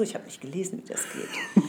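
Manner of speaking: hushed aside, barely voiced; whispering. Real, not acted. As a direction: whisper it like a confession. Whispered aside about the rules.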